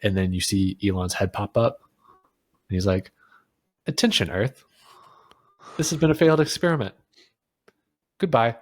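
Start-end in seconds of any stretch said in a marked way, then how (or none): 3.9-8.6 deadpan emergency broadcast voice; emergency alert. Retold or imitated. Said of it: "like, an emergency broadcast".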